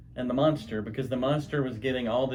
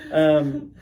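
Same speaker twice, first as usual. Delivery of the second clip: ordinary talk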